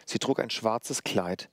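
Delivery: ordinary delivery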